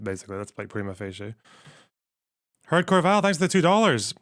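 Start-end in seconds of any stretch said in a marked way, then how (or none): 2.7-4.1 higher and louder, cheerful thanks to a donor; upbeat thanks. Real, not acted. Thanking a viewer for donation.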